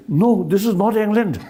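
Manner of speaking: imitating a Scottish friend, high and loud with a laugh; laughing mock Scottish correction; Scottish accent. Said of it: "You said that with a nice English Scottish accent".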